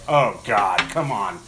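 loud, fed-up groaned protest; acted-out impatience; retold exasperation. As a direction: react like a fed-up bystander in line. Retold exasperation behind the customer.

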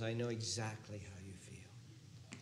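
slow, soft unvoiced hush; slow whisper. Jesus whispering to the angry, slow and hushed. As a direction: breathe it slowly, tenderly.

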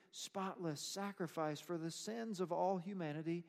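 ordinary delivery

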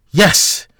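overjoyed outburst; involuntary exclamation. Acted. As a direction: blurt it out with relief. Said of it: "Jack exclaimed involuntarily".

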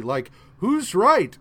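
exasperated question. Retold or imitated. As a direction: throw up your hands asking who's right. Thor puzzled about creation.

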